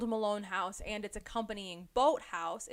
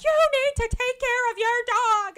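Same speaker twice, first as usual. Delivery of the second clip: high, loud shrill-free scolding voice, much higher; intense bird watcher; 14 semitones higher, 11 dB louder than usual. Retold or imitated. Bird watcher scolding the girls over their dog. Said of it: "these two intense bird watchers come out".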